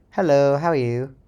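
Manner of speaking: ordinary talk, nothing marked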